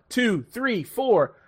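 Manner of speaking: ordinary talk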